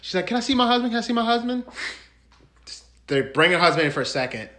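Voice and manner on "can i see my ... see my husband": high-pitched imitation of begging; mock pleading wife. Wife asking cops to see husband, mock pleading.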